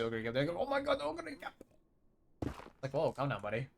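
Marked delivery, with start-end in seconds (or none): 0.6-1.5 hushed, high-pitched pretend fangirling; mock freak-out. Mocking anime commentator fawning over a horse, squeaky hushed freak-out. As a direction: imitate an overexcited commentator, high but kept soft. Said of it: "They freak out, too, when they say".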